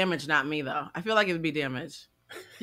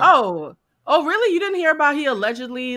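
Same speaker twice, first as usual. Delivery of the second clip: much higher, louder disbelief; surprised; 8 semitones higher, 8 dB louder than usual. Real, not acted. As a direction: gasp it out in disbelief. Shocked someone missed the gossip.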